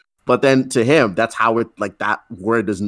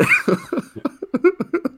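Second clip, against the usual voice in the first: high burst of laughter; laughing. Real, not acted. Friend cracking up at the joke, high laughter. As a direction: laugh helplessly, high-pitched.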